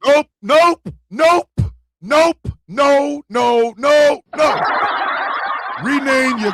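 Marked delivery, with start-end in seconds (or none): 0.0-4.6 loud, repeated real refusal; real loud nope nope no; shouting nope. Real, not acted. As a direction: shout them down, no way. Real reaction to clip.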